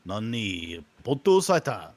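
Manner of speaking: badly faked Japanese words; mock Japanese. Teasing cohost with fake Japanese. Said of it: "Your Japanese accent's horrible".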